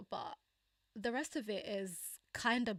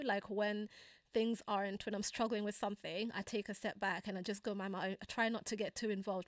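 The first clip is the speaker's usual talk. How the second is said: ordinary talk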